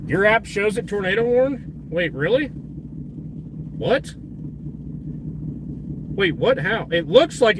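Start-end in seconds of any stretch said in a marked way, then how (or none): none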